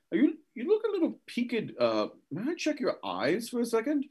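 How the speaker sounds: high, far-off genteel doctor; refined doctor voice. Acted. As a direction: inquire like a fussy physician. Player voices his doctor.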